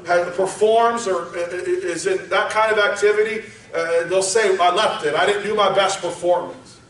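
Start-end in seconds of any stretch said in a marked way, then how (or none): none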